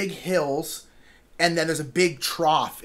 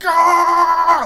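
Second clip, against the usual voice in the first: loud high drawn-out shriek; hammed-up yell of being swept away; a pretend scream while being pushed by water, loud and high; mock scream; 12 semitones higher, 11 dB louder than usual.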